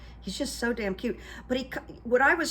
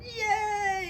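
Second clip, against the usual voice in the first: much higher cheering yay; crowd cheer; 13 semitones higher than usual. Retold or imitated. Imitating the convention crowd, a high cheering yay. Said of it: "everyone went".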